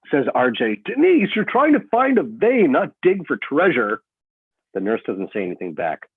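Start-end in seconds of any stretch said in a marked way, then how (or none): 0.8-4.0 annoyed complaint. Acted. RJ snaps at the nurse.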